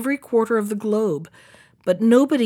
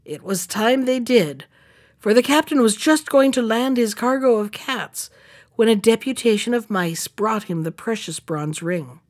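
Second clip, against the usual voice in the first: ordinary delivery, nothing marked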